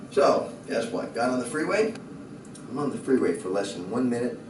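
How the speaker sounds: usual speaking voice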